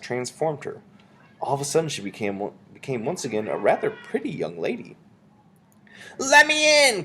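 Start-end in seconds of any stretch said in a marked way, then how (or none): 6.2-7.0 put-on high, loud demanding boy; boy calling through doors. A narrator's reading. Boy outside demands to come in, yelling high. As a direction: yell it as an impatient boy. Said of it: "came the boy's voice from behind the doors".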